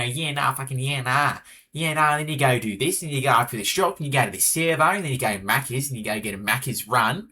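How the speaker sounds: exaggerated bogan Aussie, nasal and pushed up in pitch; way too bogan Australian accent. Shown as a demonstration. Streamer demonstrating the top level of Aussie, over-the-top bogan accent. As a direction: overdo the bogan accent, loud and through the nose. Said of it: "this is way too bogan".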